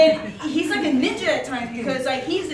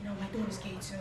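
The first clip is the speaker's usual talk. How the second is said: low, hushed imitation of the boss; serious deadpan quote; 4 semitones lower, 12 dB quieter, faster than usual. Boss confiding about his daughter, low quiet imitation. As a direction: drop it low and soft, as if confiding. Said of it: "he just stares at me".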